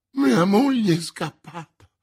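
high, loud distressed outcry; exclaiming. A narrator's reading. Prisoner telling the commissioner of his wife, exclaiming. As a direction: burst out with it loudly. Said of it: "esclamò Bonacieux".

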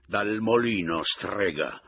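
loud, furious retort; yelling. A narrator's reading. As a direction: yell the insult at her. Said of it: "le urlai".

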